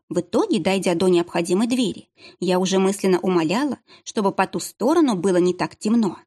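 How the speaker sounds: usual speaking voice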